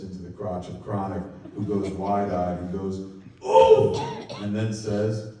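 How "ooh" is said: high, loud pained yelp. Acted. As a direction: yelp like you just got hit in the groin. Hit in crotch, goes wide-eyed.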